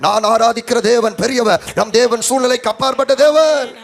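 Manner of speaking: loud pulpit rallying, building to a shout; full-force preaching. Acted. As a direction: rouse the congregation with a loud declaration.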